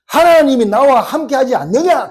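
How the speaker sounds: loud, high preacher's cry voicing David; declaimed retort. David answering his scolding brothers, loud emphatic retort. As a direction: ring it out as a forceful challenge.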